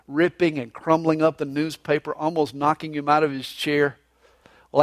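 ordinary delivery

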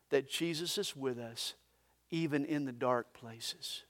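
soft and low, intimate; hushed. Real, not acted. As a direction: drop to a near hush. Hushed sermon point.

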